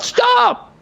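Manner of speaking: high urgent shout; yelling stop. Retold or imitated. Yelling at the bus driver to stop, high urgent shout. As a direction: yell to halt the bus. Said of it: "He says".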